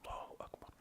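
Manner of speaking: barely voiced Allahu Akbar, lips moving; whispered takbir. Shown as a demonstration. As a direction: whisper the takbir just to yourself.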